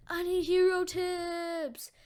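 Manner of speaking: high, drawn-out acted call; pleading call. Deku clinging to All Might's legs, pleading. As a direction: stretch it out high while hanging on.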